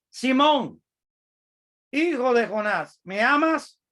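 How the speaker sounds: high, loud, searching question to Peter; pleading call. Acted. Jesus calling out loud to Peter, asking if he loves him.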